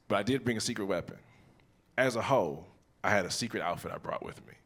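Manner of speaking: ordinary talk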